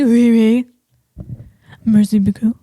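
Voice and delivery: mock French accent. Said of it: "They talk the French".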